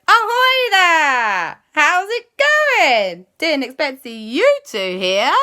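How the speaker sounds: high, loud, slow sing-song ahoy; hailing call. Acted. Auntie Lisa hailing the boat from afar, slow loud call. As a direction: call out across the water, drawn out.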